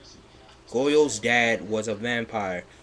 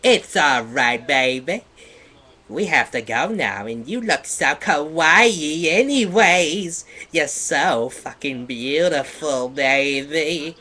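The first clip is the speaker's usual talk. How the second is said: slow, louder seductive purr; sexy voice; 4 semitones higher, 7 dB louder than usual. Shown as a demonstration. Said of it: "let me read that again in a sexy voice".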